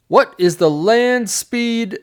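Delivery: high, loud, drawn-out mock challenge; bridge questioner. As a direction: intone the question slowly and high like a comic gatekeeper. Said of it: "the next guy says".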